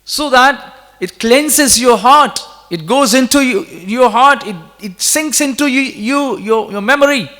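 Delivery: slow, high-pitched exhortation; fervent preaching. Real, not acted. Impassioned exhortation to listeners.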